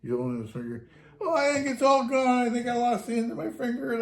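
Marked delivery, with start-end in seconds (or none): 1.2-4.0 much higher, louder mock agony; fake pained wail. Prankster driver to his captain, hammed-up pained wailing. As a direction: ham up losing a fingertip. Driver fakes lost fingertip.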